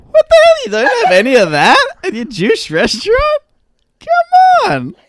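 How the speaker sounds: high, loud hammed-up yell; mock outraged shout. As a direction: shriek at the restaurant in fake fury. Outraged diner bit.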